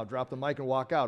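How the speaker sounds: ordinary delivery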